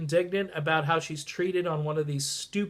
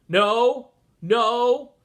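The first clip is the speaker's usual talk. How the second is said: mock shout; 7 semitones higher, 9 dB louder than usual. Mock reply to own tweet.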